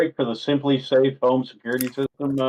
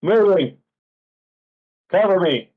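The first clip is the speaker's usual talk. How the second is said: higher, louder mock soldier call; raised-voice pretend combat shout; tactical call-out; 7 semitones higher, 4 dB louder than usual. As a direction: call it out short to the squad. Calling out moves in game.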